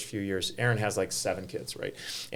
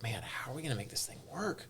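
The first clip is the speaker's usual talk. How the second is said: much softer, recalled private doubt; hushed worry; 12 dB quieter than usual. Retold or imitated. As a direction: drop right down, as if wondering to yourself. Voicing early doubts about the event.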